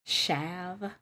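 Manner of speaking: "Shav" with SH and a as in cat; short-a SH variant. Shown as a demonstration. Trying another option, "Shav" with short a. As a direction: say "Shav" to rhyme with have. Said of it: "I wouldn't think ah".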